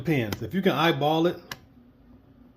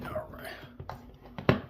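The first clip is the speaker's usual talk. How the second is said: whispering; 5 dB quieter than usual. Real, not acted. Muttering to himself while working.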